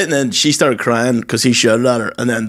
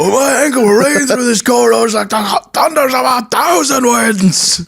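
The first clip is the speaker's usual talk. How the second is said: much higher, hammed-up theatrical rant; dramatic Dennis impression; 12 semitones higher than usual. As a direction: overact it like a pompous TV character. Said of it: "Dennis from Always Sunny".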